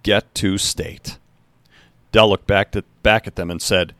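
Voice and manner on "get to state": drawn out and quieter; slow it down to drive it home; slow, pointed. A narrator's reading. Coach pointing at the board, slow emphasis. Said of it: "he said, turning and pointing".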